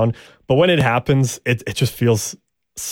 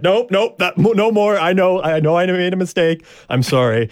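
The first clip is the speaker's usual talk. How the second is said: higher-pitched imitation of frantic backing down; mock surrender; 7 semitones higher than usual. Host imitates the downed fighter giving up, in a high mock plea. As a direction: play a beaten fighter pleading to stop.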